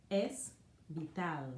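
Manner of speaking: es vital said the Spanish way, es bee-TAHL; Spanish pronunciation. Shown as a demonstration.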